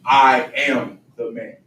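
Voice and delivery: "I am the man" said slowly, each word weighted; slow declaration. Shown as a demonstration. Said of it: "Have that declaration".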